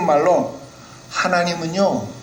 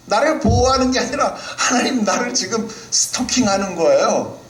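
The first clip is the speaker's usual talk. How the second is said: Job's whining complaint; 10 semitones higher than usual. Acted.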